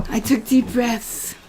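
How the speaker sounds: normal talking voice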